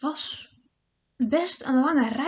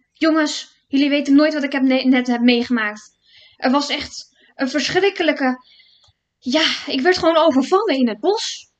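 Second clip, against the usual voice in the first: breathless excitement; 3 semitones higher, 8 dB louder than usual. A narrator's reading. Broertje vertelt buiten adem.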